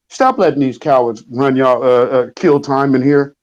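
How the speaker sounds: ordinary delivery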